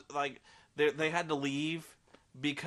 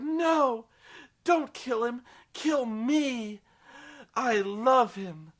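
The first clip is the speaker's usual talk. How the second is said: much higher, louder mock pleading; hammed-up cry, high and loud, last words slow; melodramatic plea; 8 semitones higher, 4 dB louder, much slower than usual. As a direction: overplay a desperate heroine begging. Bella begs for Edward's life.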